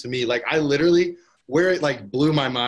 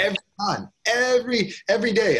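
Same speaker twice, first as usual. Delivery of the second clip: excited emphasis; 9 semitones higher than usual. Real, not acted. Stressing a life-changing realization.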